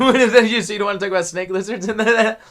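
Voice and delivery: high, loud, laughing question. Real, not acted. Teasing guest about snake lizards, laughing. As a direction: crack up while teasing the guest.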